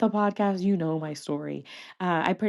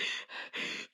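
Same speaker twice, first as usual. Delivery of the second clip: imitated out-of-breath puff; huffing and puffing; 14 dB quieter than usual. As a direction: huff as if winded between words. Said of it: "huffing and puffing".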